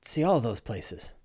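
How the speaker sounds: lightly voiced deferential squire, soft and polite; a hint of a humble servant saying sir to his master; servile sidekick. GM voices Bing.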